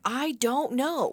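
high, very slow, drawn-out answer; mock-innocent child reply; imitating little son. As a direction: stretch it out like a clueless small kid. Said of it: "he's like".

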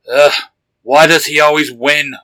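grumbling. A narrator's reading. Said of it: "Hart grumbled".

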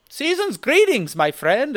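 high, lilting mock German accent. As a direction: put on a little German accent. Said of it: "German accent in the end".

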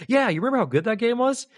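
high, upbeat mock nostalgia; fond reminiscing. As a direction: recall it fondly with a lifted, keen pitch. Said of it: "we could think back and be like".

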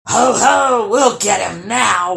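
loud, slow troll roar. A narrator's reading. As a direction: roar it in triumph like hunting trolls. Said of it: "roared the trolls".

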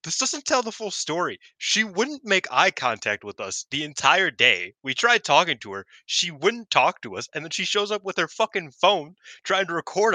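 imitating Nanae complaining about her teammate; mock indignant rant. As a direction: pile on the grievances until you blow up. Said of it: "she's like".

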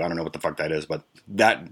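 ordinary talk

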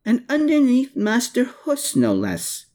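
put-on a high, exclaiming girl's voice; exclaimed. A narrator's reading. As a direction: burst out, scandalised. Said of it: "she exclaimed".

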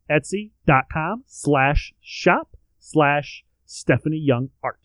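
slow, careful spelling out; said slowly. Real, not acted. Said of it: "saying it slowly".

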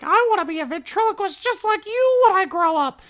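put-on very high little-boy voice; little kid. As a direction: squeak it like an eager child. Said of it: "the little kid".

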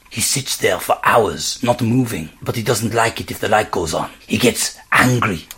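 put-on whispered French accent. A narrator's reading. Frenchman warning about the meditating man, hushed French accent. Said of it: "whispered the man, still with a French accent".

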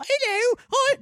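very high-pitched put-on voice, missing Scottish; Mrs. Doubtfire. Host failing at Scottish for his co-host, high comic character voice. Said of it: "That's Mrs. Doubtfire".

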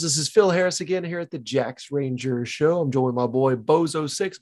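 ordinary talk, nothing marked

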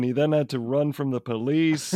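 normal talking voice

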